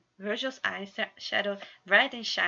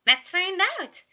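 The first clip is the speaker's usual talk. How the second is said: high, loud eager cry; gleeful exclaim; 12 semitones higher, 11 dB louder than usual. A narrator's reading. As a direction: burst out with mischief. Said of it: "Remus exclaimed".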